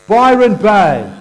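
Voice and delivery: put-on proudly repeating a child's hometown. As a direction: announce it like a point of pride. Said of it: "said proudly".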